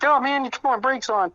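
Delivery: half-playful yell of annoyance; mock yell. Friend with locked brakes yelling at pranksters, mock-annoyed yell.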